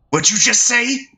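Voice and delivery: very high, threatening gang leader; mock-tough provoked punk; menacing challenge. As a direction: dare him to repeat it.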